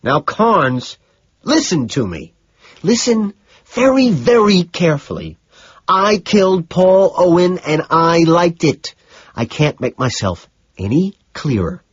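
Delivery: high, strained, emphatic confession; stressed, choked insistence. A narrator's reading. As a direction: force the words out slowly under stress. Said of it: "My stress causes me to choke on the words".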